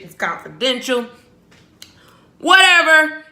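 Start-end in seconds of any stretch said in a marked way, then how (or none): 2.4-3.2 excited shout. Real, not acted. Excited outburst.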